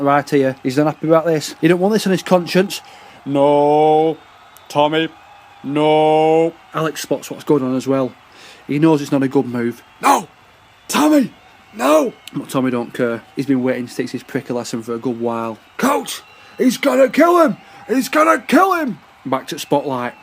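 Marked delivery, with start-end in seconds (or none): 3.3-6.5 long drawn-out "No. Tommy. No."; coach's warning call. Acted. Coach calls out to stop Tommy. 10.0-12.1 high-pitched, urgent teammate's yelp; panicked cry. Acted. Teammate cries out to stop Tommy. 15.8-19.0 high-pitched panicked shouting; frantic alarm. Acted. Teammate frantically warns the coach.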